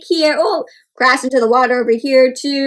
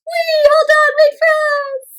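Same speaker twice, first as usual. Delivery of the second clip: much higher, squeaky chasing cry; penguins calling; 13 semitones higher, 4 dB louder than usual. Retold or imitated. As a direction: cry out while hurrying after.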